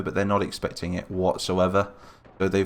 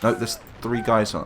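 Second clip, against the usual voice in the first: ordinary delivery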